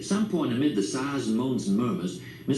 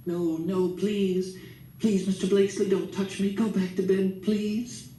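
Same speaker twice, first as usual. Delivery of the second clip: pleading woman; 8 semitones higher than usual. A narrator's reading. Miss Love pleading, pushing Grandpa away.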